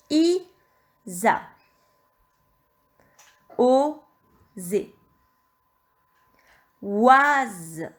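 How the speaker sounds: "Isa", "Oser", "Oise" loud and drawn out; syllable dictation. Shown as a demonstration. Teacher rereading syllables for checking, slow and deliberate. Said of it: "Je vais relire les cinq syllabes".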